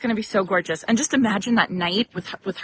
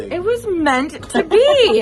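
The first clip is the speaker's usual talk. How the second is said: higher, louder, slow and sing-song; gushing echo; 9 semitones higher, 6 dB louder, slower than usual. Real, not acted. Host echoes Chris with gushing delight. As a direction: savor the coincidence. Echoing guest's remark.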